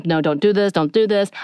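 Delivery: usual speaking voice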